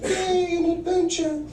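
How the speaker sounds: put-on high, childlike glee; mock excited mom. Son imitating his mother delighted by a mall trip, high glee. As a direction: squeal it like a thrilled kid. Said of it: "She was like".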